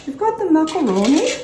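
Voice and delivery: high-pitched mock TV cook voice, rising question; Julia Child. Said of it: "Julia Child".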